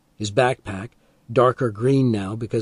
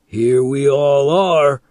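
grinning; 3 semitones higher, 7 dB louder, slower than usual. A narrator's reading.

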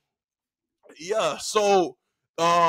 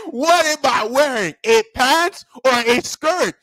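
slow, raised-pitch demand; stern teacher; 7 semitones higher, 4 dB louder, slower than usual. Retold or imitated. Teacher makes two boys answer about his clothes, stern. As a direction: hammer out each word of the choice.

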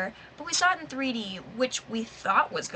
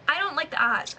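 ordinary delivery

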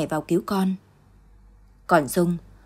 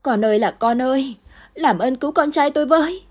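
high, loud anguished scream; mother screaming for her child in the fire; screaming until hoarse; 7 semitones higher, 4 dB louder than usual. Acted. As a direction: cry out with everything left. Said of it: "la hét đến khản cả cổ".

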